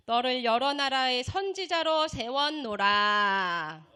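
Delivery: slow, loud, solemn recitation; scripture reading. Real, not acted. Preacher reads Jeremiah to the congregation, solemnly proclaiming. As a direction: proclaim the verse, drawing out the ending. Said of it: "제가 읽겠습니다".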